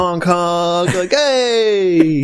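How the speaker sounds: high, stretched imitation of honking and yelling; mock traffic yelling. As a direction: imitate honking horns and a yelling New Yorker. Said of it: "yell at you".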